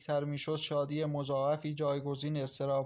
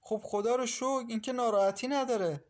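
higher, lively relief; bright burst of good news; higher and louder, thrilled; excited; 8 semitones higher, 3 dB louder than usual. A narrator's reading. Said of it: "شهره با هیجان گفت".

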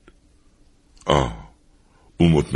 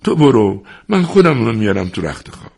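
put-on raised, urgent pleading old man; higher, louder grandfather's plea to a child; 7 semitones higher, 3 dB louder than usual. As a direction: coax the child, begging him to let go. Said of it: "با خواهش و التماس گفت".

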